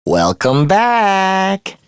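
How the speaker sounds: very slow, stretched-out taunting welcome; drawn-out mock welcome. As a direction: stretch BACK out long and teasingly. Villain greets captive mockingly again.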